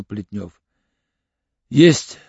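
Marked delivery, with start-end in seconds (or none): none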